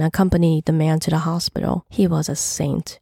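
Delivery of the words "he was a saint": usual speaking voice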